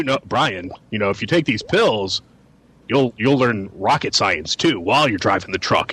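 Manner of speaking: mock persuader. Friend mocking a pill pusher, low and coaxing. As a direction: sell him the pills in a low coaxing tone. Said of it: "somebody came along and convinced you".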